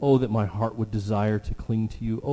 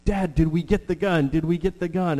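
lightly voiced boy, higher and pestering; eager son; 6 semitones higher, 3 dB louder than usual. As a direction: nag Dad like an impatient kid. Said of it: "he'd come up to me and he'd say".

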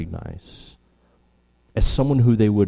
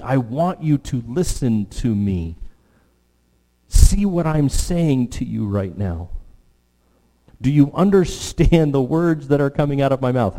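put-on stern parent to child; 5 semitones higher, 4 dB louder than usual. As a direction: hold a child's face and make them listen. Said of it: "grabbing them, as it were, by the face like children and saying".

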